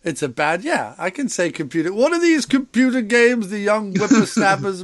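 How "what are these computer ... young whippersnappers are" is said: a hint of a cranky old man, higher and louder; grumpy old man. Joking back at a friend who called him old, a lightly voiced cranky old man. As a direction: play a grumbling old-timer complaining about youth. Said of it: "you old man".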